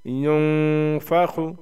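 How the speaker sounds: युनफखु with the न held long as a nasal hum; nasal ghunna. Shown as a demonstration. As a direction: hide the न of युनफखु in the nose and draw it out. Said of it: "नाक में आवाज़ को छुपा के".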